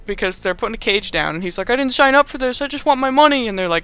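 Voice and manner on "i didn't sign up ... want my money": high-pitched, worried protest; mock pleading. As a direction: plead your way out, imitating a panicked kid. Peter pleads from the cage.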